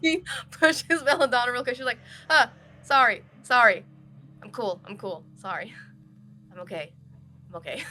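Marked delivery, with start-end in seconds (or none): 2.3-3.9 loud, higher flustered sorry; acted jolt of embarrassment; startled apology. Startled character pushes, then apologises. 4.4-7.8 composing oneself. Acted. Character calms herself after startle.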